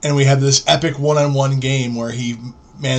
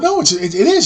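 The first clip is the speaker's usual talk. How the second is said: insistent; 9 semitones higher, 4 dB louder, faster than usual. Retold or imitated. Insisting the die is real.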